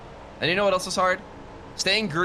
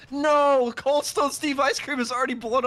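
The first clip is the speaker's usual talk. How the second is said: dismayed shout; 10 semitones higher than usual. Real, not acted. Car blown up in wreck.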